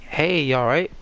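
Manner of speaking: bright cheery hello; friendly greeting. Pretend. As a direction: sound harmless and friendly. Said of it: "if I would have been like".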